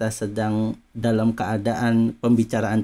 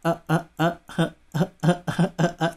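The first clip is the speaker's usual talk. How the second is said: halting, stuck on ah, unable to get words out; mock tongue-tied stammer; 4 semitones higher than usual. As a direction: imitate someone whose tongue can't keep up. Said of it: "terbata-bata".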